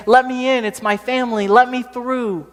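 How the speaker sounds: put-on high-pitched urgent young man's plea. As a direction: plead to get through to your family. Young man pleading at a fire.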